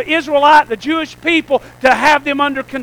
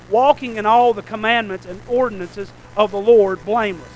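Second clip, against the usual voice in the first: softer, steady reading of the verse; scripture reading; 5 semitones lower, 6 dB quieter than usual. Acted. Pastor reading Luke to the congregation, steady and soft. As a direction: read the passage to the church.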